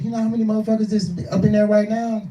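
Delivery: high-pitched urgent disbelief; incredulous. Retold or imitated. Driver warns rider about the crowd inside, incredulous. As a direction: press the question high, amazed at the rider.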